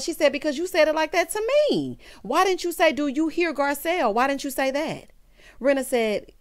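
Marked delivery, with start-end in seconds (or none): 0.3-5.1 higher-pitched mock indignation; retold heated retort. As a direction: imitate someone firing back, pitched up.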